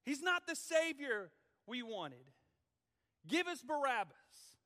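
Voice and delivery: loud, high demand to crucify; crowd screaming. Acted. Angry crowd screaming at Pilate for crucifixion, loud. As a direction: turn on him, yell for his death. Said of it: "would scream".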